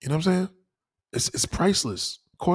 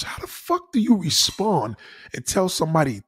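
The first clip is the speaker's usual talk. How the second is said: ordinary talk, nothing marked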